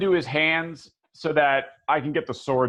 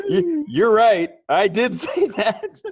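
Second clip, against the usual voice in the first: laughing, slow and louder; 6 semitones higher, 5 dB louder, slower than usual. Real, not acted. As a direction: laugh it out, conceding. Caught out, laughing.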